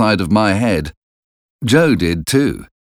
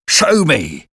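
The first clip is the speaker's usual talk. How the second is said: higher, curt convict command; menacing convict; 8 semitones higher than usual. A narrator's reading. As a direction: order the boy threateningly. Convict orders the boy.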